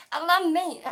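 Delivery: loud, high-pitched outburst of delight; hammed-up joyful yell; gleeful shout. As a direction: holler it with over-the-top relish. Exclaiming exaggerated love of meat.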